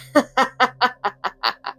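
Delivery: high mocking laughter; teasing laugh. A narrator's reading. Katana laughing at Raiden as he leaves, mocking laugh. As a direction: cackle at his expense. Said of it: "Katana laughed".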